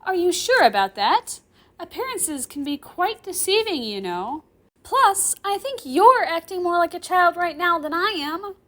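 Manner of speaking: put-on high, loud, smug girl with a grin; arrogant. Girl grinning arrogantly at her professor, smug. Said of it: "grinning at me arrogantly".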